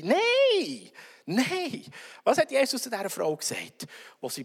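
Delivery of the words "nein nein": high cry of no, fading on the repeat; a high pleading no; emphatic no. Acted. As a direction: protest sharply, then settle. Acted emphatic denial.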